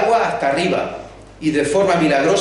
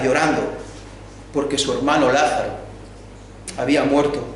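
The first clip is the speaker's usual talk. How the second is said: ordinary talk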